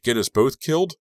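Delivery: high, loud accusing yell; screaming. A narrator's reading. Driver to Lucas after near getting killed, screaming. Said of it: "I screamed".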